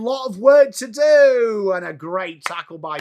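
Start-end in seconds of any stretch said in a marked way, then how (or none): 0.0-1.7 high, loud and drawn out; excited play call. Real, not acted. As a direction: lift the voice as play builds.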